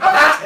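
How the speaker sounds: short, high, loud cry of alarm; startled yelp imitated. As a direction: yelp as if being charged at. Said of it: "he just went like".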